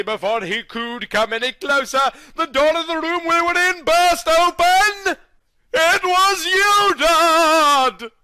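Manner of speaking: hammed-up melodramatic climax; building dramatic reveal. A narrator's reading. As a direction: build to the big rescue.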